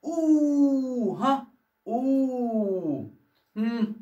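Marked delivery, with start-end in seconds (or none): 0.0-1.1 أو held very long on a steady note; lengthened vowel. Shown as a demonstration. Teacher models waw lengthening for pupils, أو slowly sustained. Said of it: "هطول الضم". 1.9-3.1 slow, sustained "oo" on أو; lengthened vowel. Shown as a demonstration. Teacher repeats the lengthening for pupils, أو stretched out. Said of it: "هطول الضم".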